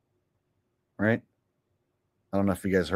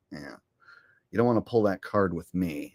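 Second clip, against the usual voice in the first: ordinary talk, nothing marked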